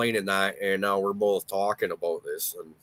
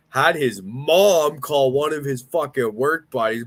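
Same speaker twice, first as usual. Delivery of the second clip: ordinary delivery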